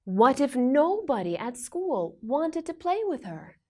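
ordinary delivery

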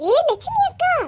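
high, ringing call to a neighbour's house. Acted. Angry neighbour Geetha calls Lakshmi out to quarrel, high calling voice. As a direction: shout her name across the yard.

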